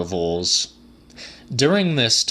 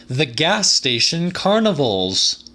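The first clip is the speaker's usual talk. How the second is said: higher voice, proud, landing a punchline; proud reveal, pitched up; punchline delivery; 7 semitones higher, 3 dB louder than usual. A narrator's reading. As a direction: deliver it like the payoff of a joke. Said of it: "as if he were someone delivering a punchline to a joke".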